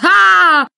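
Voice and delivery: long, high, loud cry; acted scream. Else screams against her will, high loud cry. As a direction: scream out at full voice. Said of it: "ich muss immer schreien".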